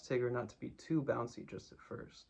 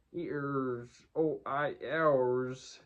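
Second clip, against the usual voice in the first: mumbled through a mouthful of acorns, getting louder; mouth full; 5 dB louder, much slower than usual. Acted. Tigger mumbling to Pooh and Piglet, mouth full of acorns. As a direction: talk around a big mouthful. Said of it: "his mouth was full of acorns".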